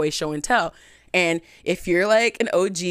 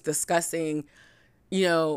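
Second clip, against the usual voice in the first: ordinary talk, nothing marked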